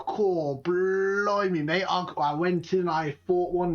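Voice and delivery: proud explorer bragging about his fight; cockney adventurer. Retold or imitated. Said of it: "And they're like".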